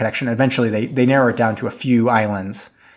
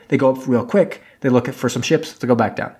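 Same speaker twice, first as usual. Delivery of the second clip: usual speaking voice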